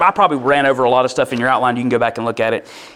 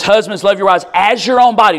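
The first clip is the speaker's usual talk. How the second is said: loud, higher proclaiming of Husbands, love your wives; forceful reading; 7 semitones higher, 7 dB louder than usual. Real, not acted. Preacher proclaiming scripture to the husbands, loud and high. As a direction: read the verse out like a charge.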